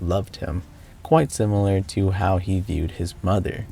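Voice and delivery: steady recap narration voice; story narration. Acted. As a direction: narrate the game's ending evenly.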